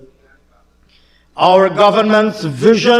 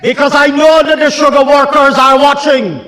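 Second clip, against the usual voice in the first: emphatic repeat; 3 semitones higher than usual. Shown as a demonstration. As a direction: hammer it out for the workers listening. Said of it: "Let me repeat it for emphasis".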